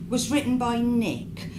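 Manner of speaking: usual speaking voice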